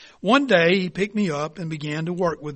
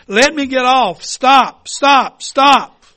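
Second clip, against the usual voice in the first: loud, high desperate scream; 6 semitones higher, 10 dB louder, much slower than usual. Acted. Teacup screams to the potter to stop the wheel, panicked. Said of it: "And I screamed".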